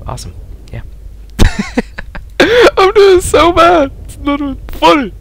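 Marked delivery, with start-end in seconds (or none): 2.4-5.1 despairing whine. Real, not acted. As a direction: squeal it out in comic despair. Laments a bad score.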